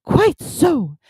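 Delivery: high, loud, squeaky-small Piglet voice; trying to sound brave. A narrator's reading. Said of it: "still more bravely".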